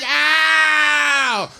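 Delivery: short loud high yelp; mock pained yelp. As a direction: yelp like she got hit. Woman cries out in quote.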